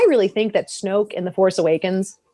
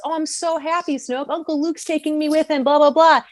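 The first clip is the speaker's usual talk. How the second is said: higher-pitched, happy boy imitation; Ben; 8 semitones higher than usual. Boy tells his false friend about his uncle, happy. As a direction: gush like an innocent kid. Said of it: "Ben goes".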